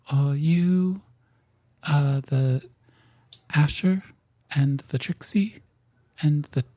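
halting questions, searching for each name; small messenger being. Acted.

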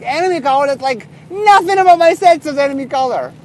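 high, loud, incredulous objection; indignant protest. Retold or imitated. Retold protest at enemy colour request.

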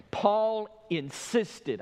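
"insisted" drawn out and punched on the middle syllable; slow right down and hit "insisted"; high, very slow, weighty "insisted"; stress on insisted. Real, not acted. Preacher highlights word to underline.

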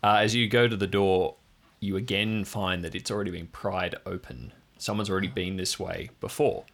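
ordinary delivery

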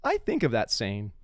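ordinary delivery

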